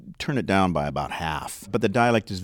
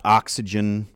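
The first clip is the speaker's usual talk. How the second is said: ordinary talk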